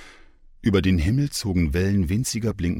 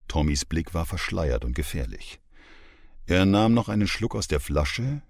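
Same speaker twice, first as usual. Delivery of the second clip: ordinary delivery, nothing marked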